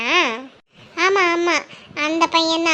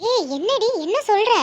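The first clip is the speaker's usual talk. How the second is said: surprised village woman; 5 semitones higher than usual. Acted. Geetha reacts to gossip.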